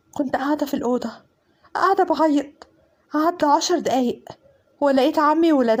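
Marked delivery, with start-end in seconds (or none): none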